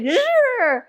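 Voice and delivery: high zooming motor noise; imitated Segway whir. Imitating Wozniak riding up on a Segway, high whir. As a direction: zip past with a high mock whir. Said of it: "he'd be like".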